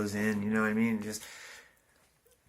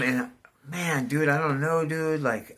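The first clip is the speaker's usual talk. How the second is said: normal talking voice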